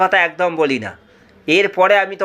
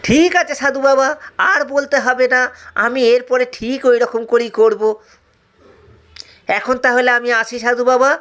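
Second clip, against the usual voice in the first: put-on high, eager young disciple voice; a naive disciple chirping to the sage; simple disciple; 5 semitones higher than usual. Disciple answering the sage in a high, eager voice.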